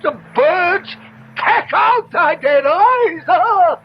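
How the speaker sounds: bait him with a shout; goading shout. Acted. Stranger goads him, shouting.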